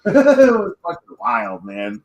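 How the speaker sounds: real laughter stretching out the words; laughing. Real, not acted. Laughing while recalling the mess.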